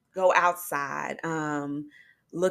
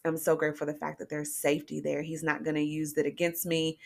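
usual speaking voice

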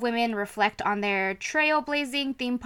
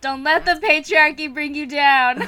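excited; 5 semitones higher, 8 dB louder than usual. Real, not acted. Chanting the show slogan.

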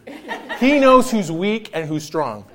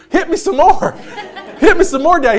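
high, bright dog begging for more, laughing; eager dog voice; 8 semitones higher, 3 dB louder than usual. Retold or imitated. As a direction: voice the excited dog, pitched way up. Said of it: "He was all like".

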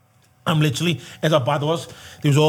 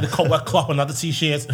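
ordinary talk

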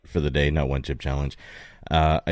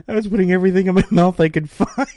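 talking through laughter; fast, laughing through the words; 12 semitones higher, 5 dB louder, faster than usual. Real, not acted. Laughing while telling story.

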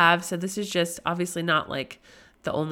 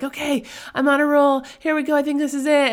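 excited; 8 semitones higher, 5 dB louder than usual. Retold or imitated. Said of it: "you've heard me be like".

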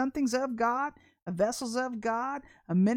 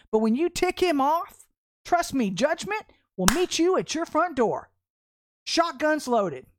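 loud, higher, full-force sermon voice; fiery preaching; 6 semitones higher, 8 dB louder than usual. Acted. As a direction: preach it hard at the congregation.

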